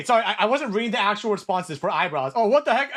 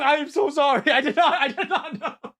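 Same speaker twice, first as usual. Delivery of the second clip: laughing apology; 6 semitones higher than usual. Real, not acted.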